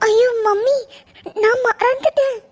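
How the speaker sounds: crying out, caught and scared; அழுகையுடன் பேசுவது. Acted. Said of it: "angel ஏன் அழுறா?".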